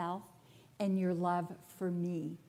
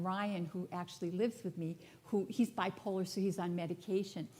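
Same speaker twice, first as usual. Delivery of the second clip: usual speaking voice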